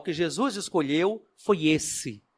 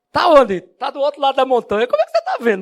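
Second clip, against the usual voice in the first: incredulous disciple; 8 semitones higher, 7 dB louder, faster than usual. Acted. Acted dialogue with disciple.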